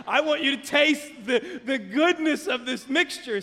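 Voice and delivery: high, loud mock pleading; hammed-up fatherly urging; exasperated dad. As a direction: plead with a stubborn son. Exasperated dad pleading with picky son.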